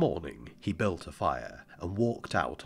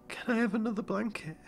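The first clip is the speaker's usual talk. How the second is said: put-on small, higher child voice; boy's voice; faster than usual. A narrator's reading. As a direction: voice the boy, light and young.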